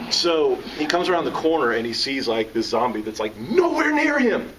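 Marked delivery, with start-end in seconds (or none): none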